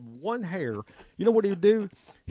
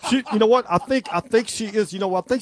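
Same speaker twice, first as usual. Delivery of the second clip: excited outburst; 6 semitones higher, 5 dB louder than usual. Real, not acted. Host reacts to Pelosi joke.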